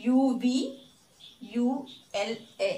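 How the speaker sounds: letters of uvula one by one; spelled out slowly for the class; spelling it out. Shown as a demonstration. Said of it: "ഈ position".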